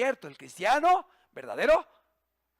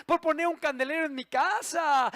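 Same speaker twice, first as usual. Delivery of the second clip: ordinary talk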